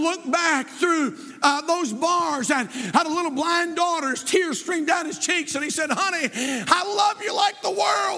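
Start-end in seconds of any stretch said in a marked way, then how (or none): 5.9-8.2 John Bunyan's reply. Acted. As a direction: cry out a father's vow through tears. Bunyan answers daughter through bars.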